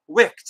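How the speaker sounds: Wicked as one syllable, "wikt"; Wicked rhyming with kicked; misread title. Retold or imitated. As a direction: say Wicked like Wick plus a t. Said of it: "that's not Wicked. It's Wicked".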